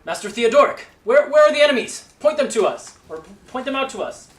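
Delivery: loud, high shout from below; shouting guards. Acted. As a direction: call down loudly, confused.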